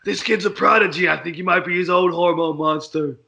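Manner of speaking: raspy hormone monster impression; Maury. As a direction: growl it like a lusty monster. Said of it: "Maury's the best".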